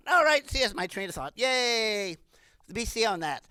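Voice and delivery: ordinary talk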